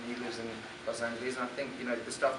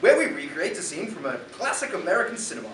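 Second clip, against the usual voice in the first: put-on theatrical, higher and louder English accent; dramatic English accent; 7 semitones higher, 10 dB louder than usual. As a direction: announce it grandly in an English accent. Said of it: "we need a little bit more dramatic".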